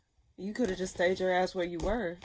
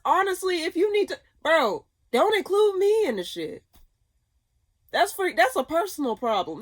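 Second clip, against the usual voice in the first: shouting, much higher and louder, fed up; ranting; 8 semitones higher, 6 dB louder than usual. Real, not acted. Reader telling off people clearing their conscience, furious shout. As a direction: let the frustration boil over into a yell. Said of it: "Why am I ranting?".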